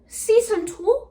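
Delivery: high, slow, startled question; mock surprise. Vlogger catching her own slip, mock surprise. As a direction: gasp it like it's news.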